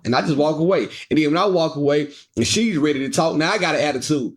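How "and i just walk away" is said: usual speaking voice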